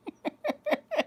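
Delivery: high-pitched amused laugh. Real, not acted. Mother amused by her own demand, laughing.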